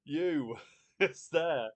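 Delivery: loud, high, laughing through words. Real, not acted. Host laughing as a joke guess proves right. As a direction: laugh while revealing it.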